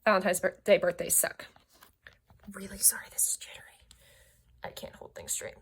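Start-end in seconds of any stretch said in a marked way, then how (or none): 2.5-5.5 whispering. Real, not acted. Aside apology while handling book.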